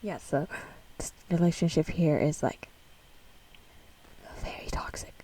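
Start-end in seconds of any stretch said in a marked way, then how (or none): 4.4-5.1 hushed verdict; whisper. Real, not acted. Vlogger whispers that the relationship is very toxic, hushed. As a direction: whisper it under the breath.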